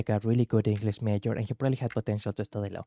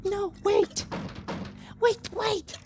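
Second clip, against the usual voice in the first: desperate high call; slower than usual. Acted. High desperate calling character.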